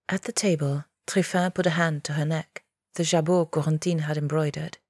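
ordinary delivery, nothing marked

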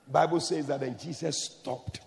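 normal talking voice

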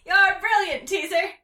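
high, loud delighted praise; joyful cheer. A narrator's reading. Mungo Jerry rejoicing to Teaser, excited. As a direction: cheer your clever sister.

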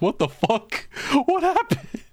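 confused dog voice. Acted. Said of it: "dog's just looking around like".

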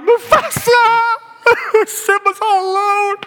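drawn-out, high wail; exaggerated movie-goer's heartbreak, very high and slow; mock sobbing. Preacher reliving his Lion King cry, hammed-up sobbing. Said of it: "I sobbed".

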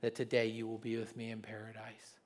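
low, soft, tender promise; quiet tender promise; gentle Jesus. Acted. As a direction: speak softly as Jesus comforting. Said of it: "And Jesus said".

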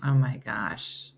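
slow groan. Real, not acted. Waking up early on a cold Monday, slow drawn-out groan. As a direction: stretch it out like you just woke up. Groggy morning exclamation.

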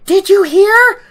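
put-on high, loud girlish squeal; giddy excitement. Girl shouting her news to Rand, high and giddy. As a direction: burst out with the news. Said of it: "And she's like".

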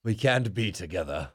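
a hammed-up, barely British breakup line; bad British accent. Acting out a Total Divas breakup in bad British accent. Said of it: "That's the worst British accent I've ever heard".

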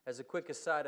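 usual speaking voice

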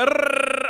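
high loud growl; mock frustrated roar; growling rant noise. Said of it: "He's like".

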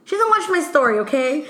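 high-pitched, insistent retold complaint; exasperated explanation. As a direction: spell out the grievance, mock-exasperated. Retold exchange, defending her grievance.

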